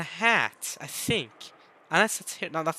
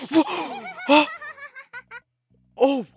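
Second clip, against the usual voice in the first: startled gasp. Real, not acted. Jump scare gasp.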